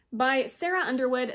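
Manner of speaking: normal talking voice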